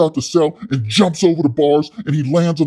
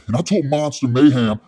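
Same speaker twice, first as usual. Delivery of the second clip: normal talking voice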